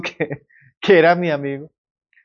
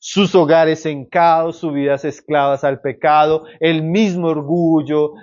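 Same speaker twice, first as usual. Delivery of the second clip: normal talking voice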